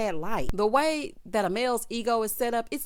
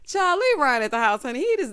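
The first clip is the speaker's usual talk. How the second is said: normal talking voice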